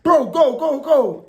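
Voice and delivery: high, loud urgent yell of a friend; urgent shout. Retold or imitated. Friend on the back urges the rider to flee, shouting. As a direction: yell at him to ride off. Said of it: "He was like".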